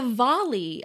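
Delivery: Diwali with the W leaning to V. Shown as a demonstration. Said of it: "saying it more like a V".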